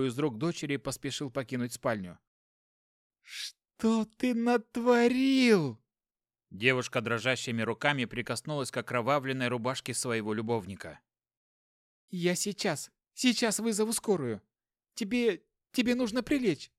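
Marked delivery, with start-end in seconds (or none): 3.2-5.8 high slow horrified cry. Acted. Girlfriend seeing the wound. 12.1-16.7 high frantic pleading; fluster, pitch climbing high; panicked. Acted. Girlfriend panicking over wounded lover.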